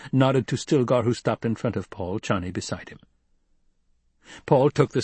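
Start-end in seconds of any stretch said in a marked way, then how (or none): none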